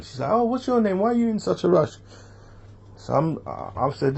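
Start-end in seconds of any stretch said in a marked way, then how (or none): 0.3-2.0 high-pitched mock girl's voice; imitated girl. Girl questioning a man in a hurry, imitated in high voice. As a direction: put on a much higher voice for her questions.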